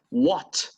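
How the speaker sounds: "what" with a strongly released T; "what" ending in a crisp, audible T; British final T. Shown as a demonstration. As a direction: say "what" British style, making the T sound a lot. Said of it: "Yes, that was British".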